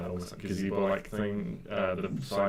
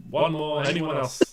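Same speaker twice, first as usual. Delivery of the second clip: loud, higher crier's call; 8 semitones higher, 7 dB louder than usual. Acted. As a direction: shout for one last taker. Host calling out to the crowd.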